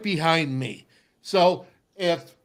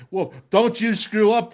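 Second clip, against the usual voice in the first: retold warning, higher and louder; older teammate's warning; 5 semitones higher, 5 dB louder than usual. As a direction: lean on the rookie with a stern warning. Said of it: "he's like".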